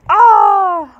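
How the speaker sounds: high, loud frightened yell. A narrator's reading. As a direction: scream in terror at the sudden light. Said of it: "couldn't stop yelling".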